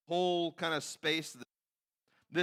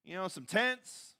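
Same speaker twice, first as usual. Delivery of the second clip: higher-pitched, chipper mock Peter; Peter's eager suggestion; 6 semitones higher than usual. Disciple pitching tents and food to Jesus, naively eager. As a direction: pitch it up, offering helpful plans. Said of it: "he hears Peter's comment".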